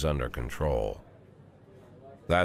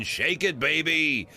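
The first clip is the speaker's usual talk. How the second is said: high, rowdy whoop; excited yell, pitched way up; 11 semitones higher, 3 dB louder, slower than usual. Acted. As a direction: holler it high like a rowdy reveler.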